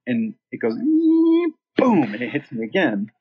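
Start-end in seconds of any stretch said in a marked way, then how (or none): 0.8-2.1 a high, loud mock "boom" of the shock going off; imitated shock boom. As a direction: hit it like a sudden electric blast. Imitates defibrillator firing on stage.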